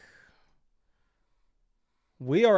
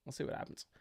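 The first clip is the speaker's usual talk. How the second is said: whispers the line to himself, teasing; a hushed aside, barely voiced; 14 dB quieter, faster than usual. Shown as a demonstration. Repeats the line, then whispers it.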